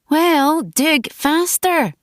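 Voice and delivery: high, drawn-out exasperated order; slow, high, impatient urging; impatient retort. A narrator's reading. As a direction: stretch the words out, pitched high and exasperated. Impatient retort in graveside dialogue.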